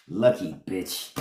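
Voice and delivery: low, slow, grudging aside; jealous mutter. Real, not acted. Muttering about her sister-in-law's luck, low and grudging.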